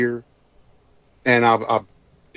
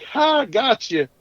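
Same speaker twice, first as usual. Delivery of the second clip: teasing gotcha; 9 semitones higher, slower than usual. Retold or imitated. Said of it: "I'm like".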